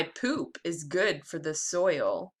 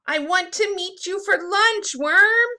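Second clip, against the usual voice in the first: much higher, louder bird voice; bluebird voice; 11 semitones higher, 8 dB louder than usual. Acted. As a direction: squawk it like a hungry bird. Bluebird calls the worm.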